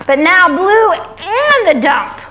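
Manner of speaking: animated, swooping picture-book narration; story reading to children. A narrator's reading. Reading the little truck's rescue to kids, animated storytelling. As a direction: draw out the suspense, lifting key words high.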